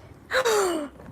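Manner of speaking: high, drawn-out gasp of delight; big excited intake. Real, not acted. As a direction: gasp in thrilled anticipation.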